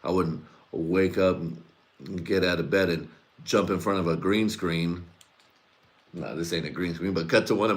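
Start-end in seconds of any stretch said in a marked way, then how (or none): none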